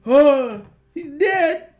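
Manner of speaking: rising into a loud, high panicked yell; yelling in fright that the man is dead; panicked shout. Retold or imitated. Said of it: "And I was like".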